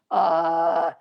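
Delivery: low, drawn-out creaky "uh"; croaky. Shown as a demonstration. Teacher shows pushing from the throat, low croaky sound. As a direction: push from the throat until it croaks. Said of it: "go croaky".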